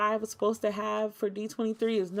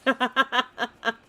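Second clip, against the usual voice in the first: loud laugh; 5 semitones higher, 8 dB louder than usual. Real, not acted. Laughing at own joke.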